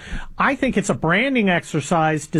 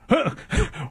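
laughing; 6 semitones higher, 4 dB quieter than usual. Real, not acted. Host laughs at the irony.

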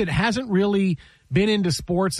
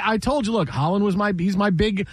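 usual speaking voice

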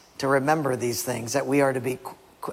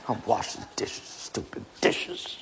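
softer, whispered and slow grumble; whispered grumbling; 6 semitones lower, 8 dB quieter than usual. Acted. Husband whispering complaints at the dishes, slow and sour. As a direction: hiss the complaint at the sink. Grumbling under breath skit.

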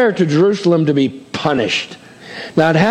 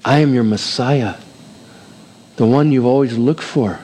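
gentle voice of Jesus; 7 semitones lower than usual. Acted. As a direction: speak low, like a patient Lord. Jesus speaking to Paul.

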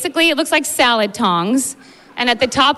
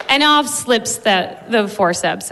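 ordinary delivery

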